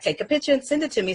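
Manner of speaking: high, fast, eager request; quick eager ask; excited request. Retold or imitated. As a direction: rattle it off brightly. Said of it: "it's like".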